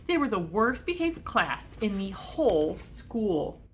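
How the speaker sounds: plain reading voice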